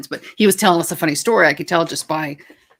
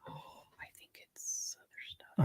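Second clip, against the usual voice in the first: hushed aside under the breath; whisper; 17 dB quieter than usual. Real, not acted. Wife whispering an aside about her husband.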